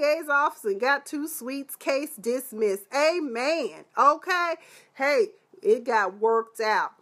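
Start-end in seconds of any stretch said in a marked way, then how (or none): none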